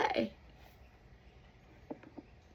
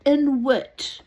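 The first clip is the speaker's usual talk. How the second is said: "Inuit" repeated louder, falling firmly; emphatic correction; 7 semitones higher, 10 dB louder than usual. Shown as a demonstration. Speaker correcting people of Earth, louder. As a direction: drive "Inuit" home. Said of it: "it's Inuit".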